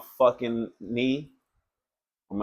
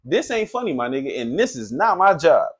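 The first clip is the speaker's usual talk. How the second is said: louder, higher scolding; telling off the boyfriend; 7 semitones higher, 6 dB louder than usual. Retold or imitated. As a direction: snap at him to come help.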